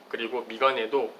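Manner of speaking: ordinary talk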